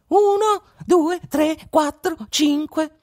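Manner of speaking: tiny high voice echoing the count, slowing down; mocking echo. A narrator's reading. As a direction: mimic the robber's count one last time from the bush. Cecino echoes the count once more. (fa il verso con vocina acuta)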